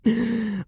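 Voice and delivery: short laugh, pitched high; a delighted little laugh. Acted. Vampire amused by lover's trick.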